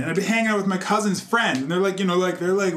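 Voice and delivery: ordinary talk, nothing marked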